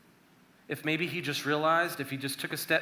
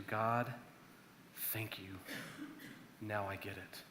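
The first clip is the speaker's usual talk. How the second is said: lower, much softer thank you; quiet gratitude; 6 semitones lower, 13 dB quieter, slower than usual. Retold or imitated. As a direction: breathe it out in relief. David voiced grateful after prayer.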